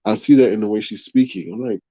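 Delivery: usual speaking voice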